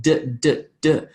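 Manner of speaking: D as separate short taps; tongue to the roof of the mouth for each D; tongue-tap consonant. Shown as a demonstration. Teacher showing where the tongue goes, isolated D demo. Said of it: "tongue against the roof of my mouth".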